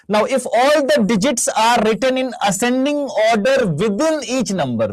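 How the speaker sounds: deliberate read-aloud, high and drawn out; careful question reading. Shown as a demonstration. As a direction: read the question out slowly, phrase by phrase. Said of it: "बच्चा से पढ़ा".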